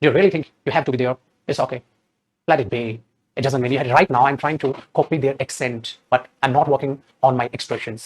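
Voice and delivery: soft mock native English accent, slow; copied native accent. Shown as a demonstration. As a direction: mimic a native speaker without feeling. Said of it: "तुम उनको copy करते हो".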